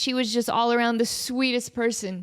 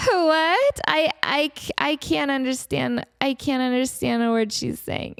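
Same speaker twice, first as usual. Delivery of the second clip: confused idol; 4 semitones higher than usual. Retold or imitated. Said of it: "her just being like".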